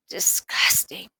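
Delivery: whispered, slow disgust; breathy whisper of disgust. A narrator's reading. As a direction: recoil from the taste. Bite tastes awful.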